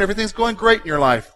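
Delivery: normal talking voice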